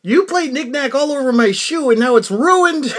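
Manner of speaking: high, loud, mock-crying complaint; whiny upset caller. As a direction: wail that the shoe is ruined. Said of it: "he takes a call from a".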